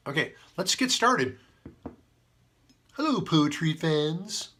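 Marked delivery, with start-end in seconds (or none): 2.9-4.5 slow cartoon moose voice; Bullwinkle impersonation. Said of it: "That's my Bullwinkle impersonation".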